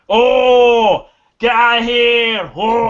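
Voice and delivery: loud, high, drawn-out hollering; mock battle yell. Durgan swinging his hammer for show, mock battle yell. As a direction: holler big for show, stretching each word. Acts Durgan faking an attack.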